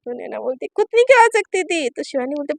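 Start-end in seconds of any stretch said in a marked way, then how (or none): 0.8-1.9 higher, louder, annoyed; irritated snap. Acted. Naina complaining about her wife leaving medicine, irritated. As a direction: gripe sharply.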